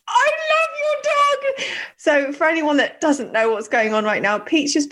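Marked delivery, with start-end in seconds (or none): 0.1-1.7 high, slow cooing to the dog. Real, not acted. Host cooing lovingly at the dogs. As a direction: coo at the puppy in a much higher voice.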